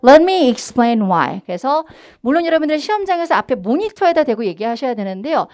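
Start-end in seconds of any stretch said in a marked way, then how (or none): 0.1-1.4 "Let me explain why" loud, key words punched; content-word stress. Shown as a demonstration. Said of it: "한번 해볼게요".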